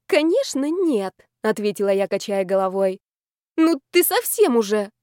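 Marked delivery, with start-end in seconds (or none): 0.1-1.1 high, affronted reply; higher-pitched tipsy protest; emphatic denial. A narrator's reading. Tipsy heroine denies affair. 3.6-4.9 high, exclaiming tipsy indignation; higher, affronted outburst; outraged reproach. A narrator's reading. Friends bicker, heroine outraged.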